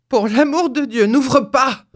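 urgent, fearful plea; trembling exclamation. A narrator's reading. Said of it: "s'exclama le vieillard tout tremblant".

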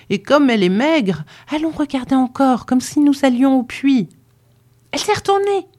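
put-on high, eager young girl's voice; two curious girls whispering about the sick lady, high and eager; gossiping girls. A narrator's reading.

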